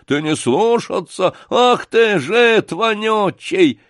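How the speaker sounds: loud, high-pitched furious bawl; roaring. Acted. Гундосый to Сашка for disobeying, enraged roar. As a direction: roar the insult, drawing out the last words. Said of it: "заревел Гундосый".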